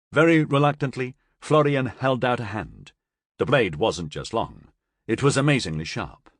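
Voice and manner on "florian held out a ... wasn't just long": ordinary delivery, nothing marked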